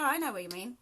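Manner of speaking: ordinary delivery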